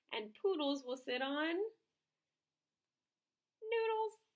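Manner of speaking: proud frog. Acted. Frog lists rhymes.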